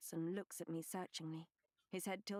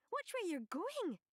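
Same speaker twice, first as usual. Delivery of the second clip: angry servant; 11 semitones higher, 4 dB louder than usual. A narrator's reading. Servant snaps after collision.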